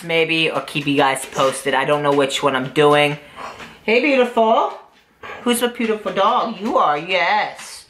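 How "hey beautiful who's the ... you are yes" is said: dog talk voice. Real, not acted. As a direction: coo at the dog, slowing at the end. Talking sweetly to the dog.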